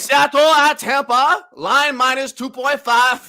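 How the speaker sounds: high, loud, drawn-out attempt at German; bad German accent. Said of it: "Let's do a German accent".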